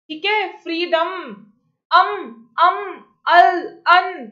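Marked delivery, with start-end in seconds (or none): 1.9-4.2 अम, अम, अल, अन as isolated sounds, each closing on its consonant; syllabic consonant demo. Shown as a demonstration. Teacher naming syllabic consonants for students, अम, अल, अन sounded out in isolation. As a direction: sound out अम, अल, अन separately and firmly. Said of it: "they are called syllabic consonants".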